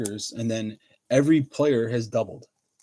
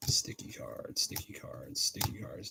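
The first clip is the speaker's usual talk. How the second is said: hushed, voiceless repeated chant; whispering; 10 semitones lower, 13 dB quieter, slower than usual. Real, not acted. Card breaker chanting to himself over sticky cards, whispering. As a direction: whisper it under your breath.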